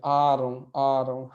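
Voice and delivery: आ रहा हूँ with a loud first आ; teacher drilling the stressed आ. Shown as a demonstration. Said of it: "पे एक जोर है".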